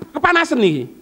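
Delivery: normal talking voice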